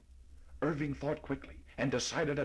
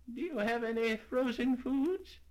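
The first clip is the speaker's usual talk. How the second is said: put-on old lady; 11 semitones higher than usual.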